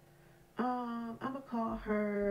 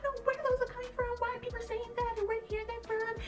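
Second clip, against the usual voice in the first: high, whiny mock-innocent voice, much higher; mock whiny coworker; 11 semitones higher than usual. Mocking the crying coworker to her supervisor, high whiny voice. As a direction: play the fake-crying coworker, pitched way up.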